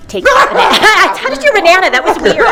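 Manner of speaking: high, loud silly outburst; goofy teasing burst; playful exclaim. Acted. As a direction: guest teasing host over his banana, high silly outburst. Playful banana bit.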